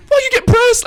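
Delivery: very high, loud squealed comeback; mock exasperation. Teller taunts woman in the quarrel, squealed comeback. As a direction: yelp the retort in comic disbelief.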